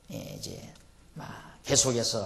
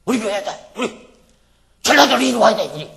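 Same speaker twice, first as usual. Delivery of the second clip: much higher, loud calling out to gather people; rallying shout; 7 semitones higher, 15 dB louder than usual. Retold or imitated. Pastor calling people from his home region to his church, loud rallying shout. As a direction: yell out to draw them in. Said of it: "이러면서".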